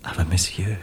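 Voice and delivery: soft, breathless whisper of protest; whispered plea to stop; shocked whisper. Acted. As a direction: hush him, gently appalled. Shocked friend hushing him.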